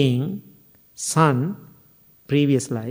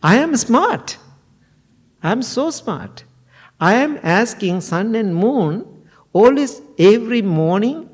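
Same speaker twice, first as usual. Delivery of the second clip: indignant retort; 4 semitones higher than usual. Acted. Divine son turns the insult back on his father, indignant and higher. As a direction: throw the insult back proudly. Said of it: "young divine being said".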